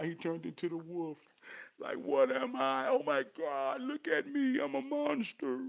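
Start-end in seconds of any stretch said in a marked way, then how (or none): 2.0-5.6 high-pitched, acted panic; scared boy. Teen seeing a wolf in the mirror, panicked. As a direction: play a horrified teen, voice mostly pitched up. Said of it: "He scared".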